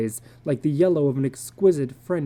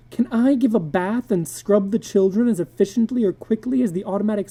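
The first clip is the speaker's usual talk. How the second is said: higher, strained troubled wife; anxious wife Lydia; 7 semitones higher, 4 dB louder than usual. A narrator's reading. Wife confessing to husband she feels useless, anxious. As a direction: pour out her worry about the house.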